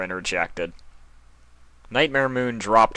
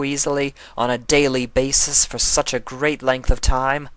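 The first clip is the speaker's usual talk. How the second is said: a hint of a resentful dark queen; lightly voiced scornful villainess; bitter queen voice. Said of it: "She leered at Chrysalis".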